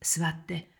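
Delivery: soft hushed plea; quietly whispered request. Retold or imitated. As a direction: whisper so the guests can't hear. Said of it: "私は小さな声で".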